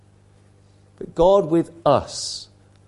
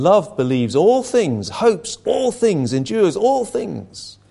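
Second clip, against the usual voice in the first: slow and rising higher on each "all"; solemn scripture quote; 5 semitones higher, slower than usual. Retold or imitated. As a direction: lean into every "all", slowly climbing. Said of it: "The Apostle Paul said".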